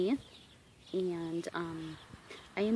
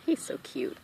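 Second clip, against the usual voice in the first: doting coo; 10 semitones higher than usual. Real, not acted. Cooing over horse on lap.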